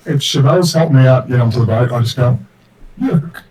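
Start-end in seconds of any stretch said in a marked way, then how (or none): 3.0-3.4 put-on drunken dwarf voice. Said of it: "in a drunken dwarven voice".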